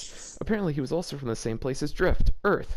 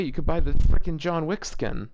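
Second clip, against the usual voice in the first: ordinary delivery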